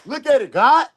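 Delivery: loud, high and thrilled; excited shout. Real, not acted. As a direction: shout it out in joy. Excited shout about the series.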